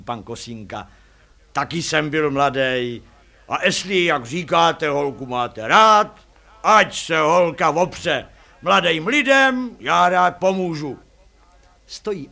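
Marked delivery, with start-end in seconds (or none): 1.5-11.0 loud, slow, hearty-volume driver; taxi driver character. A narrator's reading. Taxi driver Kosinka agrees to help the couple, loud character voice. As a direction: boom it out, stretching the words.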